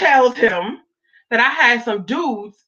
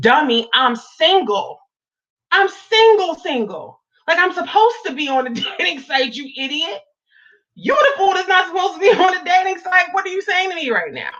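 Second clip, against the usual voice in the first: heated retort; 8 semitones higher than usual. Retold or imitated. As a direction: scold him like a fool for the dating site. Retelling the fight with her boyfriend.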